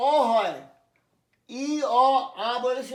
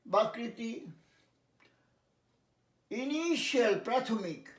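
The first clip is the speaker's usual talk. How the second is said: ordinary talk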